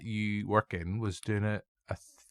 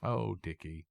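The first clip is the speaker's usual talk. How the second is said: mock grief; 9 dB quieter, slower than usual. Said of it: "Robert Downey Junior just going".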